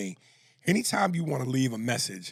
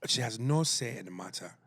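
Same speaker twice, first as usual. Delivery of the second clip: put-on African accent; 2 semitones lower, 4 dB quieter than usual. Host revives his character's catchphrase in an African accent. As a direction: deliver it as a generic African man. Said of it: "doing a random African voice".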